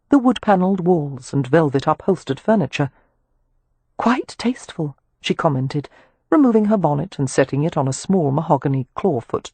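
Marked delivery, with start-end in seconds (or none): 4.0-5.0 a hint of a refined lady, high and soft; quietly approving; lightly voiced Amelia. Amelia admiring the room, soft and high.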